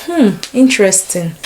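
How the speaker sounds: normal talking voice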